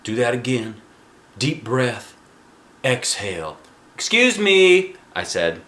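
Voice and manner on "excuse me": loud, slow, high "Excuse me"; indignant interruption. A narrator's reading. Arlo interrupting the breathing lesson, indignant. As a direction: cut in, offended.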